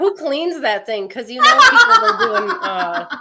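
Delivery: high, loud words through laughter; giggling, outraged question; laughing. Real, not acted. Laughing at holodeck hygiene.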